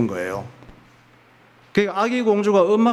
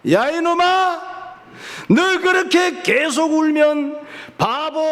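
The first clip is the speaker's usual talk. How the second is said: angry king shouting; 8 semitones higher than usual. Acted. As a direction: bellow at the crying princess from the throne. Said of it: "화가 나서 소리를 질렀어". (우는 공주에게 호통치는 임금님, 아주 높고 느리게)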